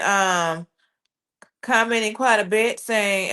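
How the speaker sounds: normal talking voice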